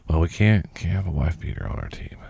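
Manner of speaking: whispered under the breath; hushed. Retold or imitated. Retelling team bosses' worry, hushed whisper. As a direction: whisper it like a nervous team exec. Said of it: "people were more worried about the perception".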